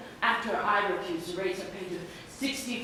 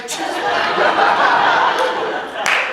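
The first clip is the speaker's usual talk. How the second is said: long, loud guffaw; loud burst of laughter; 15 dB louder than usual. Acted. Man hearing his friend's reading advice, loud guffaw.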